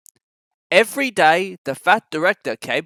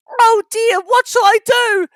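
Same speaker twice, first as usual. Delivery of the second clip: very high, louder fretful cry; worried Thomas; 8 dB louder than usual. A narrator's reading. Thomas seeing the buffers ahead, frightened. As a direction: panic over what comes next.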